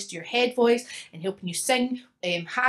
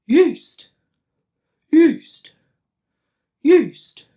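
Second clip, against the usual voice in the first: loud, higher, dopey yeast; a hammed-up dopey voice singing yeast; 6 semitones higher, 13 dB louder, much slower than usual. Shown as a demonstration. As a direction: belt out yeast like a goofy cartoon. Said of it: "So we do the word yeast".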